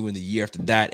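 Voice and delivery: normal talking voice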